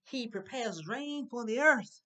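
slow, projected reading of a verse; scripture recitation. Real, not acted. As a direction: proclaim each phrase slowly to a class. Reads scripture to the class.

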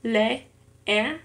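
"leer" in two beats, le, er; syllable by syllable. Shown as a demonstration. Learner showing two syllables in an exercise, "leer" split apart. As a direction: split "leer" with a pause between the two e's. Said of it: "es en dos sílabas".

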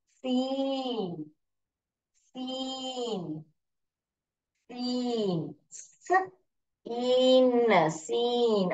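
"सीन" with a long stretched ee, slowly; phonics sounding-out. Shown as a demonstration. Teacher sounding out a word for pupils, slow phonics demonstration. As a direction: split "सीन" into "स" and "इन", then blend.

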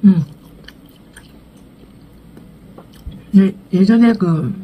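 muffled words between bites; talking while chewing. Real, not acted. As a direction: mouth full of food. Seen 3: Cheeks bulge, lips closed and chewing until about 4.5s, then plain talk.

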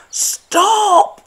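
high loud squeaking shout; squeaked. A narrator's reading. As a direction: shout at the runaway badger to stop. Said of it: "squeaked Mouse".

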